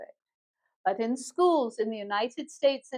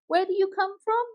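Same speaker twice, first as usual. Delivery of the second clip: higher-pitched, polite mock apology; apologetic forgetful questioner; 6 semitones higher than usual. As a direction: act out a sorry, curious question. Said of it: "you don't remember where they're from".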